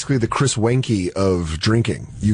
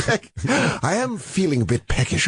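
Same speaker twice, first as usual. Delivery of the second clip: put-on old english; 4 semitones higher, slower than usual. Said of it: "I speak in old English".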